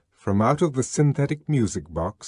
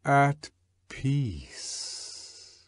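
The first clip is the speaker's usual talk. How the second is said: softer and lower, ending in a whisper; fading to a whisper; 4 semitones lower, 7 dB quieter, much slower than usual. A narrator's reading. Synthetic voice calming the crowd, fading to a whisper. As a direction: let it tremble away to nothing. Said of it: "sank into a whisper".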